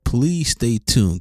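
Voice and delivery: ordinary talk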